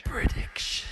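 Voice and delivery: breathy hushed echo; whispering. Pretend. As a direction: whisper the word back. Echoes host in whisper.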